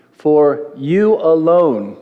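slow, drawn-out "alone"; weighty, stretched stress on "alone". Shown as a demonstration. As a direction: say "you alone" very slowly, leaning on "alone". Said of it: "emphasis on the word alone".

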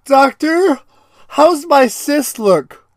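high, slow worried patient asking; mock patient pleading with the doctor. Patient asking the doctor, high and slow.

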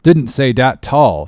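slow, mildly sulky dialect. A narrator's reading. Cook denies it to Stubb, sulkily. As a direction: grumble the denial slowly. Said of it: "again in sulks".

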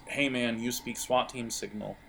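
usual speaking voice

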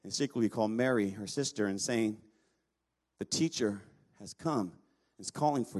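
low, measured reading of the Bible passage; scripture reading. Acted. Preacher reading the Lazarus passage to the congregation, measured and low. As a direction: read the Gospel aloud, evenly and low. Reads the Gospel passage aloud.

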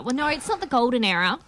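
usual speaking voice

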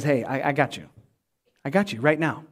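calm a frightened friend; reassuring Jesus. Acted. Jesus voiced reassuring Peter.

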